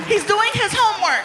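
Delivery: high woman's voice, a bit exasperated; girlfriend voice. Retold or imitated. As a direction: answer like the mother.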